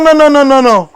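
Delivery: ordinary talk, nothing marked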